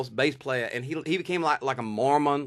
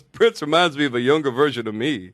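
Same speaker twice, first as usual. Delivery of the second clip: louder deadpan parody of a boast; 6 dB louder than usual. Retold or imitated. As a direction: repeat the boast to camera, pitched down. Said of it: "[name] looks at the camera and goes".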